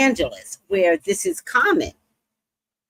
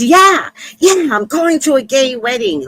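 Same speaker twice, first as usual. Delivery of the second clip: loud, high, over-excited guy; hammed-up excited yelling; kinda crazy; 6 semitones higher, 5 dB louder than usual. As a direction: imitate a guy getting wildly worked up. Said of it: "they get kinda crazy".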